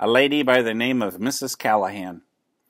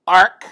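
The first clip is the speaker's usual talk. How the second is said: short offended penguin squawk, louder and higher; indignant squawk; 6 semitones higher, 8 dB louder than usual. A narrator's reading. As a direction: squawk it like an insulted bird. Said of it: "said Captain Cook indignantly".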